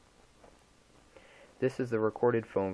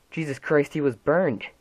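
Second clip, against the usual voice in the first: louder, higher horrified outburst; horrified cry; 6 semitones higher, 9 dB louder than usual. Acted. Mother telling the officer of her burned son, horrified cry. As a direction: cry it out in shock.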